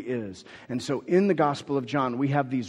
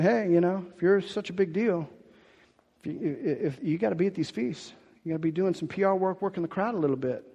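higher-pitched, needling brother; casual, teasing jab; poking at him; 4 semitones higher than usual. Retold or imitated. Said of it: "brothers are sort of poking at Jesus".

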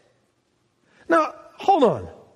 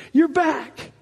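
higher, joyful cry of You're back; 8 semitones higher than usual. Retold or imitated. Father embracing his lost son, joyful. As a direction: call it out in relief.

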